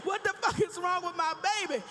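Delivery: high, loud, desperate prayer; panicked plea. Retold or imitated. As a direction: beg God in a panic. Panicked mother praying.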